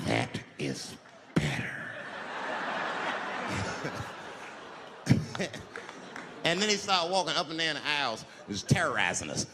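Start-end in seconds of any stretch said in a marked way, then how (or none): 0.1-1.7 much lower, slow creepy satisfaction; low creepy approval. Acted. The flasher approving the quiet bus, low creepy purr. Same line redone lower. 1.8-6.4 creepy low chuckle. Acted. Menacing laugh.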